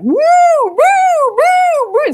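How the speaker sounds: very high, loud siren woo; alarm siren imitation. Teacher imitating her fire alarm going off, very high and loud. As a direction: wail it like a shrieking alarm.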